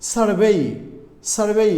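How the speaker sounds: "সার্ভে" with a stretched vay-ee ending; long final ay. Shown as a demonstration. Teacher models the word survey, long gliding ending. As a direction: lean on and draw out the end of "সার্ভে". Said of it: "উচ্চারণটা কিন্তু ঠিক রাখতে হবে".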